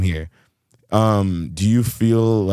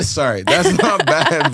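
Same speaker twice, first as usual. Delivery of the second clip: laughing; 7 semitones higher, 3 dB louder, slower than usual. Real, not acted. Laughing apology after teasing.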